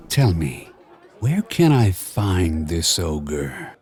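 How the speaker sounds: put-on Spanish-accented purr. A narrator's reading. Cat pleased by the gold, low Spanish purr. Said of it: "The stranger's hiss turned into a purr".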